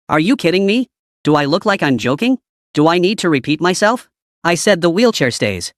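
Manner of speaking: ordinary delivery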